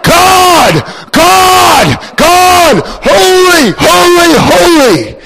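high, loud shouted worship; creatures crying holy. Acted. Creatures shouting holy before God, loud and high. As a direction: cry it out like awestruck heavenly beings.

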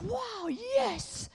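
high, amazed gasp of delight; light up with wonder. Acted. Elijah amazed by the angel's words, high and bright.

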